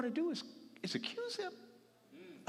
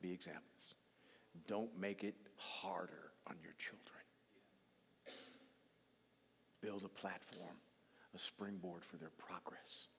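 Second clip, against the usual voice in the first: low, soft, sincere appeal; quiet earnest plea; 12 semitones lower, 8 dB quieter than usual. Real, not acted. Preacher begging parents to live right, soft and sincere. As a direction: plead gently with parents.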